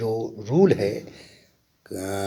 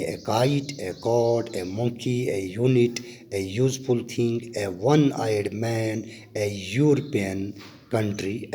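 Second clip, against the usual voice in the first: slow example reading; much slower than usual. Shown as a demonstration. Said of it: "दिया गया है".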